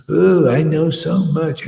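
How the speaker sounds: a light mocking whine of the ex-wife; mildly voiced smug ex-wife. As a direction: lightly mimic her smug boast. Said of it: "she says".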